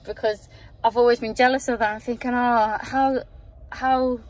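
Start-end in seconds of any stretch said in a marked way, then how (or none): none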